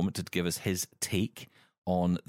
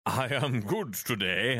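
put-on posh English accent; slower than usual. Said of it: "I have to do that accent".

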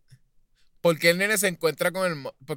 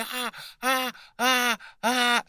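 high repeated mock cries; copying the kid's panicked screams; imitated repeated cries; 8 semitones higher, much slower than usual. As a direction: keep crying out high, over and over. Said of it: "el nene siguió ahí como que".

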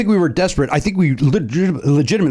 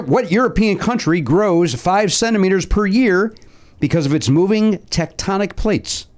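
slow, higher quizmaster delivery; quiz question read-out; 5 semitones higher, slower than usual. Acted. Host reads contestants a trivia question, slow quiz read-out. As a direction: read it out slowly and deliberately.

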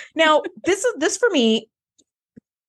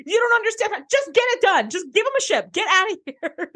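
high, rushed, exasperated Riker; hurried Riker; 8 semitones higher than usual. Retold or imitated. Podcaster imitating Riker rushing them off, high and frantic. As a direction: blurt it out high to get rid of them. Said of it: "He just immediately is like".

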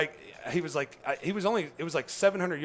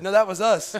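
laughing through the line, higher and louder; 8 semitones higher, 10 dB louder than usual. Retold or imitated. Laughing as he tells the old man it was them. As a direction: chuckle the punchline out. Laughing retelling his joke.

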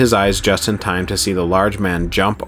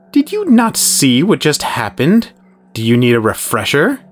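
Herod's mocking menace; 7 semitones higher, 4 dB louder than usual. A narrator's reading. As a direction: taunt him with a dangerous grin. Herod threatens Jude.

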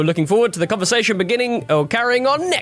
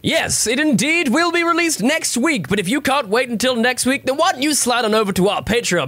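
high, bright radio anchor voice; old radio announcer; 7 semitones higher than usual. Pretend. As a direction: pitch it like a vintage radio host. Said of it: "co-anchor [name]".

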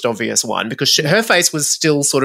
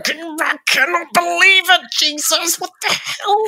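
very high, disbelieving squeal; high-pitched mock outrage; imitating exasperated Melissa. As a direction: screech it like waking up outraged at 3am. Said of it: "wake up at three o'clock in the morning going".

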